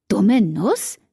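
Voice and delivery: Dumme Nuss thrown back as a sharp offended question, rising at the end; indignant echo. Acted. Cat throws the insult back at Damon, indignant and rising. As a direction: repeat Dumme Nuss in mock outrage, rising. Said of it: "Ich funkelte ihn wütend an".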